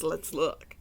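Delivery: ordinary delivery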